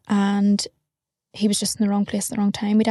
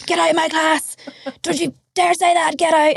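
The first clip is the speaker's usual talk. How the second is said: higher-pitched mock scolding teacher; angry nun; 6 semitones higher than usual. A nun throwing the pupil out of class, mock angry and higher. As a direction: bark her out of class as the nun.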